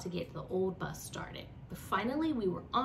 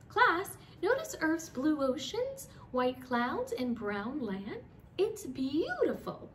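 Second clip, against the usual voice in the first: teacher voice; 6 semitones higher, slower than usual. Acted. Teacher pointing out Earth.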